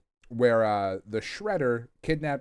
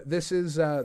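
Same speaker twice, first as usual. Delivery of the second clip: ordinary talk, nothing marked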